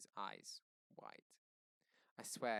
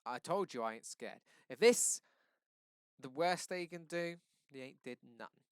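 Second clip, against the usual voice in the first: defiant; 3 semitones higher, 10 dB louder than usual. A narrator's reading. Defiant son arguing with father.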